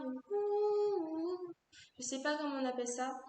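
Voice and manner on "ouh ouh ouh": put-on high, loud sung ouh sounds like a children's choir. Shown as a demonstration. Singer showing the backing choir she wants, sung ouh. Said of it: "quand on fait ah".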